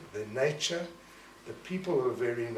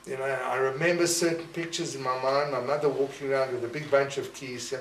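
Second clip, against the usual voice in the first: normal talking voice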